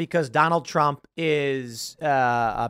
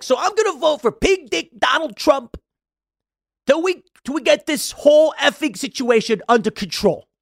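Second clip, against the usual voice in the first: much higher, louder mock impression; imitating Michael Rapaport; 10 semitones higher, 5 dB louder than usual. Podcaster mocking Rapaport's vote for Trump, much higher and louder. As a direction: lampoon him in a high whiny voice. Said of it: "I'm trying to imitate him".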